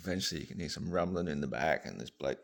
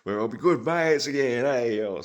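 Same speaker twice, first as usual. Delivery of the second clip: cheery electrician; 5 semitones higher, 13 dB louder than usual. Acted. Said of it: "the bloke goes".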